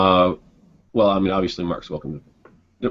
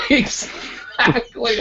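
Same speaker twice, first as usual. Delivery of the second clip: laughing; 12 semitones higher, much slower than usual. Real, not acted. Laughing at the quote.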